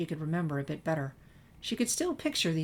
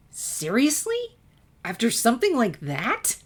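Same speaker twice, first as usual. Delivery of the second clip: shocked, indignant cry; incredulous outburst; 8 semitones higher, 6 dB louder than usual. A narrator's reading. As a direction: snap back in disbelief. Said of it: "Twilight flinched at her tone".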